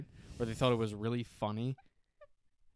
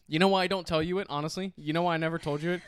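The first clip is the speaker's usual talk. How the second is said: usual speaking voice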